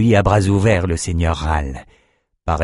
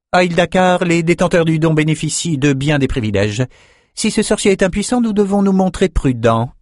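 high-pitched, measured woman ruler; high, authoritative council voice; haughty ruling sorceress; 9 semitones higher than usual. A narrator's reading. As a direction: speak as a high-voiced, cautious sovereign.

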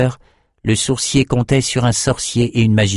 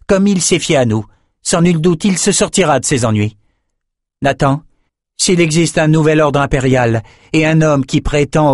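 higher, cool sorceress voice; a proud sorceress, pitched up; Nicci character voice; 7 semitones higher than usual. Acted. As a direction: speak down to the messenger, icy and sure. Nicci decides their course.

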